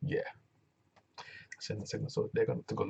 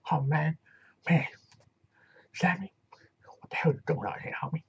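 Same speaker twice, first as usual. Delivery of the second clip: high, loud mumble with something in the mouth; mumbling Rich Swann; 5 semitones higher, 5 dB louder than usual. Retold or imitated. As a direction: talk like there's tobacco in your mouth. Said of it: "when did Rich Swann start talking like this".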